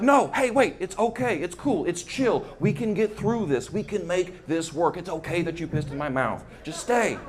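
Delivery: soft, coaxing reassurance; calm her down so she stays; diplomatic soothing. Retold or imitated. A lightly soft plea to keep the woman from leaving. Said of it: "summoned all the diplomatic power".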